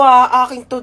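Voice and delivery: ordinary delivery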